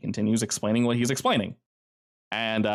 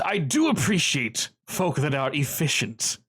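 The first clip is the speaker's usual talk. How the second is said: slow, measured crime boss; lightly voiced menacing boss, unhurried; a hint of a smug mob boss; Kincaid's voice; 3 semitones higher, slower than usual.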